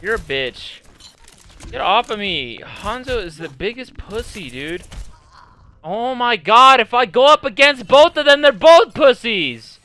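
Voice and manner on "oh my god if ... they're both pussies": loud complaint climbing in pitch; fed-up gamer yelling; exasperated rant. Real, not acted. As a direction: rant it at full voice. Ranting at two opponents.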